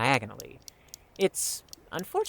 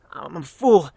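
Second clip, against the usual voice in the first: self-berating outburst; 3 semitones higher, 8 dB louder than usual. Acted. Berating himself after losing.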